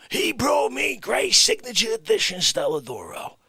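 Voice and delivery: loud wiseguy boast; shouting boast. Acted. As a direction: brag it to the rafters. Boasting about gift.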